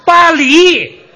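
"巴黎" said loud and high, correctly as "bā lí"; loud correction. Shown as a demonstration. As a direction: shout the correct "巴黎". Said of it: "您兜不住了". (大声纠正"巴黎")

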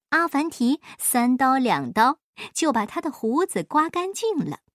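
ordinary delivery, nothing marked

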